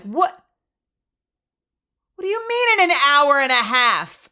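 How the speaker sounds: high, loud incredulous wail; hammed-up indignant outburst; mock outraged disbelief. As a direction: shout it back in exaggerated disbelief. Acted disbelief at long wait.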